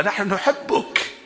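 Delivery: tender devotion. Real, not acted. Preacher addresses Imam Hussein tenderly.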